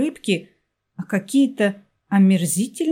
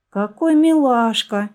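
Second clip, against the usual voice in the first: higher, cooing exclamation of delight; spontaneous admiration; 4 semitones higher, 4 dB louder than usual. A narrator's reading. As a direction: gush over a cute little animal. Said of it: "невольно восхитилась женщина".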